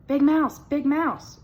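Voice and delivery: high, loud little mouse call; small frightened mouse calling his brother; little mouse crying out. A narrator's reading. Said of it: "cried Little Mouse".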